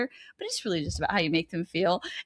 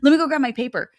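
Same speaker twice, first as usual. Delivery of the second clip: mock flustered James; 6 semitones higher, 5 dB louder than usual. Friend retells James scrambling.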